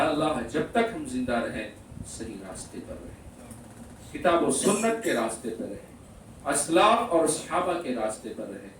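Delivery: low supplication, phrase by phrase; closing prayer. Real, not acted. Preacher asking Allah for the right path, low prayer. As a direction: plead quietly with pauses between requests.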